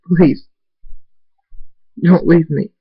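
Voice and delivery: high, loud, anguished begging; tearful pleading. A narrator's reading. Father begging missing Abel to stay, tearful pleading. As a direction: beg through tears. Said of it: "Tears run down my cheeks, and I say".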